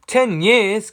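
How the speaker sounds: the king hears the charm takes ten years, gasping in shock; gasped. Acted. Gasping in disbelief, high and loud. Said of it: "gasped the king".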